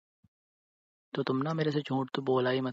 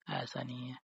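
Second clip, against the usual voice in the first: mocking echo; 11 dB quieter than usual. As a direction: mimic her denial, much softer, sulky and sarcastic. He mocks her denial. (उसकी बात की धीमी, चिढ़ाती नकल)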